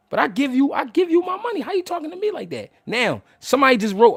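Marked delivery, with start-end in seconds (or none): 0.1-2.7 indignant retort. Retold or imitated.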